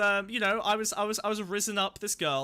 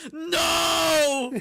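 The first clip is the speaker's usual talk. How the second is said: high, loud drawn-out shouted no; Darth Vader no scream; 10 semitones higher, 9 dB louder than usual. Retold or imitated. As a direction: bellow it like Vader's anguished no. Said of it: "I did the Darth Vader "no" scream".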